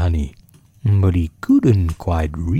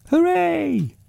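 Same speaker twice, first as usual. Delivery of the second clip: high-pitched cheer at normal volume; small, high storybook hooray; shouted cheer. A narrator's reading. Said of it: "you shouted".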